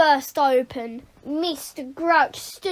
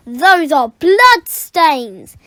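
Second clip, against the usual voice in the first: triumphant reveal; 10 dB louder, much slower than usual. Acted. Boy shows thermos.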